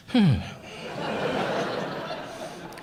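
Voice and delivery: long, voiceless exhale; winded sigh. Real, not acted. Comedian winded from little motions, long sigh. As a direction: let out a drawn-out out-of-breath sigh. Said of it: "how winded I got".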